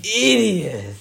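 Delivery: exasperated shout. Real, not acted. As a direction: call him an idiot in disbelief. Friend poured gas on fire.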